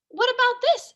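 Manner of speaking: eager discovery. Acted. Sarah finds the attic.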